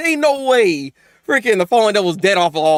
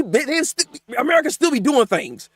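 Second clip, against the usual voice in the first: usual speaking voice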